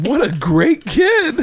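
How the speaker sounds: high, drawn-out gushing praise; gushing admiration. Retold or imitated. Acting out the impressed reaction to a kid's charity, gushing.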